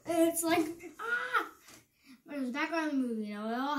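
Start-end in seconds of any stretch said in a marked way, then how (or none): 1.0-1.5 short high-pitched "ah!"; sudden high yelp of mock dismay; mock cry. Reacting to the quiz verdict.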